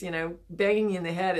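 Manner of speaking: usual speaking voice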